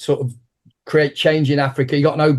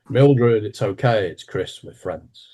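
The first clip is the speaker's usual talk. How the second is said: calming; 4 semitones lower, slower than usual. Retold or imitated. Professor calms secretary down.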